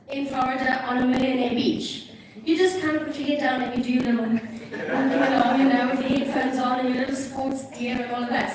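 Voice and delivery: normal talking voice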